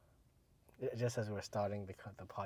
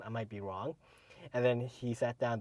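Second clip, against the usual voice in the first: ordinary delivery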